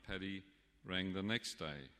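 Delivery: ordinary delivery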